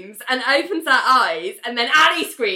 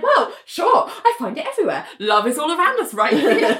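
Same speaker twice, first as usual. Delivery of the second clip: dreamy mock wisdom; 7 semitones higher than usual. Retelling Ali's dreamy answer.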